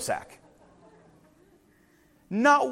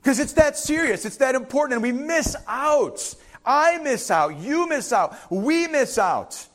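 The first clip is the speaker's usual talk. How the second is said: higher, slow, hammering each phrase; urgent preaching; 6 semitones higher, slower than usual. Acted. Pastor urging the congregation that it's serious, higher and slow. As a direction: press every word like a warning.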